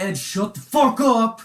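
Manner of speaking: loud, high, drawn-out retold yell; exasperated shout. Passenger silencing a friend who gave his address, exasperated shout.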